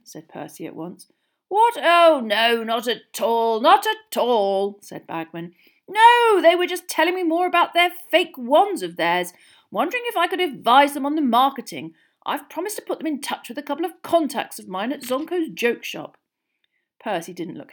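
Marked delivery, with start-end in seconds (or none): 1.5-4.8 booming, raised, hearty dismissal; jovial official. A narrator's reading. Senior official reassuring fussy young man, jovial. 5.9-16.2 high, booming enthusiasm; jovial official. A narrator's reading. Senior official explaining the twins' joke business, jovial.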